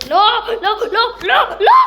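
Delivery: high, loud panicked screams. Real, not acted. (צעקות פאניקה רמות וגבוהות)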